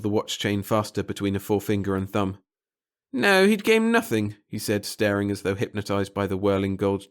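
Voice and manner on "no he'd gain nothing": high, nervous little official; a fussy, timid bureaucrat. Acted. Pettigill, hypnotized by the chain.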